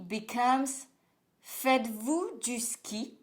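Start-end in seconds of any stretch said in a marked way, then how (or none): 1.4-3.2 "Faites-vous du ski?" slowly, with the voice going up; slow, lifted question on "Faites-vous du ski?"; rising inverted question. Shown as a demonstration. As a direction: ask "Faites-vous du ski?" in slow, careful French, raised in pitch. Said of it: "The voice goes up".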